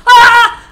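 sudden loud, high shriek; real jump-scare yelp; startled scream. Real, not acted. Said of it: "Okay, so I got scared".

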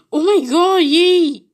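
gasp, then a high, loud cheer; thrilled. Real, not acted. Player thrilled at getting a VIP card, excited. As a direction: burst out in real excitement.

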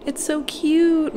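high, slow gushing. Real, not acted. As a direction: gush over it. Gushing over blanket.

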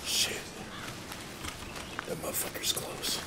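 tense breathless whisper; whisper, the enemy is near. Real, not acted. Enemy close by.

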